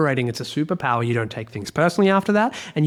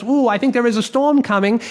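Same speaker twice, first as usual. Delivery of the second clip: a lightly voiced, higher wife sensing trouble; imitating wife; 7 semitones higher, 3 dB louder than usual. Husband mimicking his wife's warning, light high voice. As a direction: mimic her worried hunch, lightly. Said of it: "when she goes".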